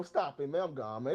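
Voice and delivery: usual speaking voice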